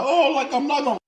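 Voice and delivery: high mocking whiny voice; mock crying whine. Mocking people who cry online in a high whine. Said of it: "on the internet crying like".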